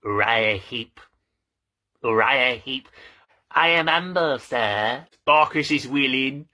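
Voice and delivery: put-on humble character voice; lightly voiced Dickens characters. Vlogger quotes David Copperfield characters, put-on voices. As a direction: play it as a hammed-up humble servant.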